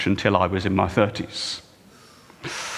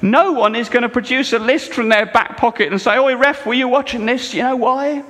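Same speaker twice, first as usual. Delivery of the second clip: much higher, much louder pulpit climax; full-force preaching; 8 dB louder than usual. Acted. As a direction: rouse the whole congregation.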